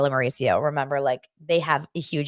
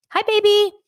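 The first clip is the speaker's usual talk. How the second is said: put-on high, loud, syrupy hi baby; sweet greeting; 6 dB louder than usual. The loving greeting the couple doesn't have, high and sugary. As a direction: gush it like a lovey couple. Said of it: "not a vibe of".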